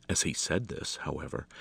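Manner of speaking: plain reading voice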